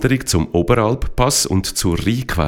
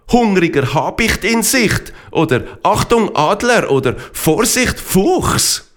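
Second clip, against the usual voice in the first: higher, earnest know-it-all; Rinex voice; 6 semitones higher than usual. Acted. Rinex correcting Fralpi about the whistles, earnest and higher. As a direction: explain the warning whistles urgently.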